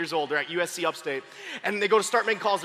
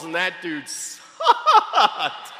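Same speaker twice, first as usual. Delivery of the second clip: SUCKED held out very long; long comic stretch on SUCKED; stress on sucked; 3 semitones lower, much slower than usual. Real, not acted. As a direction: drop into a drawn-out sucked. Repeated drawn-out punchline.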